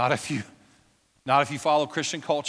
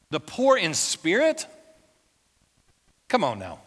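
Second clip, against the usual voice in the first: incredulous scoff; 3 semitones higher, 3 dB louder than usual. Retold or imitated. Preacher voices scoffing crowd.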